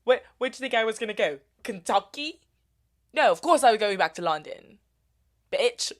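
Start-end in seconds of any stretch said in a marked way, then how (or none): 0.5-2.4 fast, mocking British accent; hammed-up British accent. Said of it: "getting better at my accent". 3.1-5.9 put-on louder British accent, scornful; British accent, spitting it out; mock British scorn. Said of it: "getting better at my accent".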